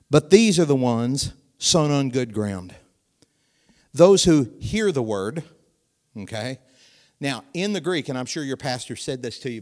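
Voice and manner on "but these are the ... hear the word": loud, slow reading of the verse; loud scripture reading. Shown as a demonstration. Preacher reading scripture aloud to the church, loud and slow. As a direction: read it out big and slow. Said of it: "Now listen to this".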